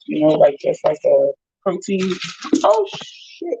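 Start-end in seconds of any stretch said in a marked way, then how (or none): 2.6-3.6 startled exclamation. Real, not acted. Spills protein shake mid-sentence.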